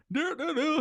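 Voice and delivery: high, carefree dopey sing-song; mock happy-go-lucky babble; happy idiot. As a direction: burble along like a blissful fool. Imitates a happy, dumb friend.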